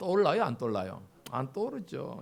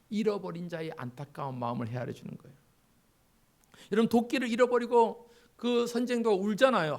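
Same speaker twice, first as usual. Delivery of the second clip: ordinary delivery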